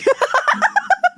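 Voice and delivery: high, squeaky helpless laughter; real laughing fit. Real, not acted. Teller cracking up at own joke, real high laughing fit. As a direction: crack up loudly, pitch shooting up.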